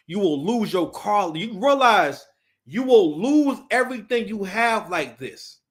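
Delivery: ordinary delivery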